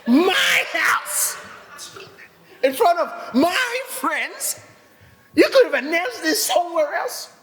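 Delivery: hammed-up wounded outrage; mock outrage shout. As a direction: let the grievance burst out. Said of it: "I was like".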